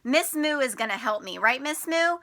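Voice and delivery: ordinary talk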